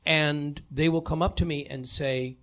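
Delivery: ordinary talk, nothing marked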